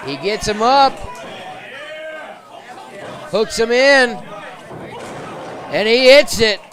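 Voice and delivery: wrestling commentator. Real, not acted. Finisher hits in live match.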